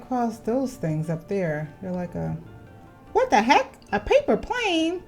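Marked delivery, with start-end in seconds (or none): none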